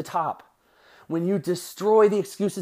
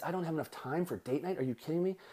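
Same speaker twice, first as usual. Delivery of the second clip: quiet, disbelieving echo of time for date night; incredulous; 2 semitones lower, 10 dB quieter than usual. Shown as a demonstration. Coach mocking a client's excuse, quietly incredulous. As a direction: drop the voice, mock disbelief at the excuse. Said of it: "it means".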